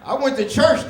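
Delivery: ordinary delivery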